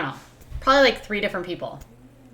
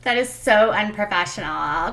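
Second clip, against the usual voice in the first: usual speaking voice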